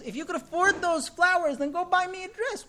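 high, loud mock demand; quarrelling wife, acted. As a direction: snap back as the wife, pitch climbing.

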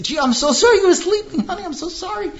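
husband mocking his wife's sugary apology; mocking her apology. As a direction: parrot her fake apology with scorn. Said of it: "and then say".

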